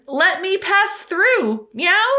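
high, slow robot cat voice. Retold or imitated. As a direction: lightly play the cute robot cat. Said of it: "the robot says things like".

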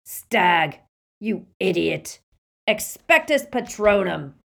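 loud, angry barman's roar; forceful shouted scorn. A narrator's reading. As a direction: roar the insult, then the spell. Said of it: "roared the barman".

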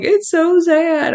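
high, slow, whiny crybaby voice; mock teary husband. Husband tearing up at a sad moment, high slow mock sob voice. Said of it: "And he's just like".

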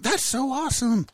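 squeaky mock-thrilled reaction; retold excitement. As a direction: imitate your own thrilled reply. Retells own thrilled reply to friend.

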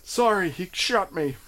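high, loud startled yelp; half-laughing apology. Retold or imitated. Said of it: "This is a game you can play".

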